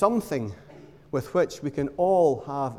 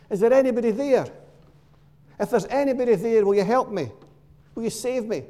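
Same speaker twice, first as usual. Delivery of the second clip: loud, raised plea upward, a little fast; calling out to heaven; 5 semitones higher, 4 dB louder, faster than usual. Acted. Man hanging from a branch calls loudly to heaven. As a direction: call for help into the sky while clinging on. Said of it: "he calls out to heaven".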